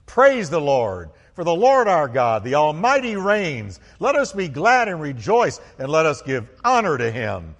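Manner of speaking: roaring, high-pitched proclamation; full-force pulpit acclamation; loud multitude praise. Acted. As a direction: shout it like a vast crowd in praise. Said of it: "That's how loud we're gonna be".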